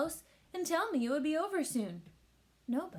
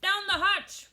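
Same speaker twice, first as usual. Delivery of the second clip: high, loud toast; all shouting; 9 semitones higher, 8 dB louder, slower than usual. A narrator's reading. Crew answers the toast, loud high shout. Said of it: "we all shouted".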